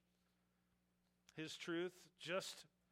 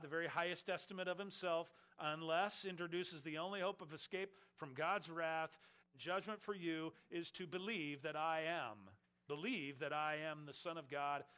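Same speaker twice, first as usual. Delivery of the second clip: rising, louder preacher's build; preaching at full force; 4 dB louder than usual. Acted. Preacher pressing the congregation to believe, building louder. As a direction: drive the point home to the congregation.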